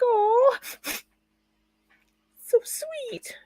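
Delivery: melting at a kind gesture; touched. Retold or imitated. Touched by friend's post.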